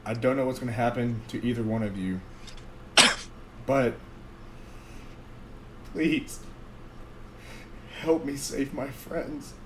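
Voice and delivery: soft, fast, resigned goodbye; a quiet last plea to friends; solemn farewell. Acted.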